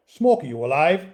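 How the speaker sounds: a hint of an Irish brogue, calling through the door; lightly voiced Irish friend calling out; Irish accent. A narrator's reading. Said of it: "came the voice in an Irish accent".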